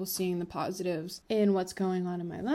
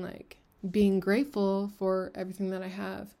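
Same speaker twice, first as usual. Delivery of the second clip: ordinary delivery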